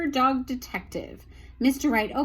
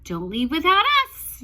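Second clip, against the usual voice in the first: louder and a little higher call; yelled; 5 semitones higher, 7 dB louder than usual. Acted. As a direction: call after them not to be left. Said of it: "yelled the apostrophes".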